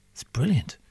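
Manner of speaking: whispered sarcastic aside. Real, not acted. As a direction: breathe it out under your breath.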